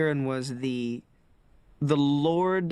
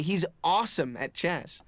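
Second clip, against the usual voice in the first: normal talking voice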